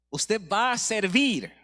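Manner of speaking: loud, slow and high, each word driven home; forceful pulpit declaration; emphatic preaching. Real, not acted. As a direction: hammer the point to the congregation. Preacher drives home his point.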